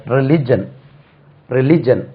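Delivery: ரிலிஜன் said ri-LI-jun, three syllables, no extra yi; religion said right. Shown as a demonstration. Teacher corrects students: ரிலிஜன், three clean syllables. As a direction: say ரிலிஜன் as ri-li-jun, not ri-li-ji-yan. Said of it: "இது ரிலிஜியன் இல்ல".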